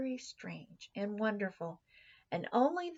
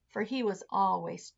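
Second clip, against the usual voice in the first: ordinary delivery, nothing marked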